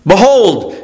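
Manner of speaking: "Behold" called out loud, high and falling; emphatic behold. Shown as a demonstration. Preacher urging sinking souls, "Behold" hit loud. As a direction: proclaim "Behold" as the sermon's emphatic word. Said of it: "illustrating the emphatic word".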